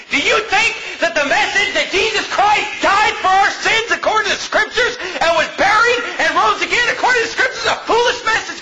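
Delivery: high-pitched, heated rhetorical question; incredulous pulpit challenge, pitched high; indignant preaching. Real, not acted.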